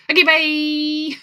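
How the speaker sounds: high, slow squeaky little farewell; cute stretched-out little bye; Tiny Chef impression. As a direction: chirp it like a tiny felt chef waving goodbye. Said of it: "at the end, he always goes".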